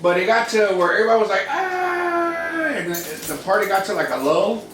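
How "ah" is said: long high-pitched "aah"; held high note of mock roaring; crowd cheer. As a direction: imitate a whole party cheering. Everybody cheering at the party.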